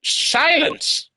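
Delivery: very high-pitched call for silence; hammed-up falsetto judge's cry; shrieked order. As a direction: screech the court to order. Judge calling the court to order.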